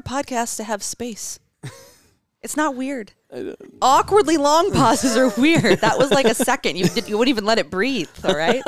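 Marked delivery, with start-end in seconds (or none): 3.8-5.8 slower, louder, deliberate; emphatic slow point. Acted. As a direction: spell it out slowly. Lecturing on podcast pauses.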